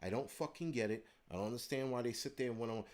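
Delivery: ordinary talk, nothing marked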